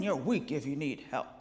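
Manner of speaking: a touch of a gruff lecturer; stern scold. Retold or imitated. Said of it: "we tell men".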